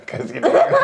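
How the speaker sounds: high laughing squeal. Real, not acted. Teased about smiling.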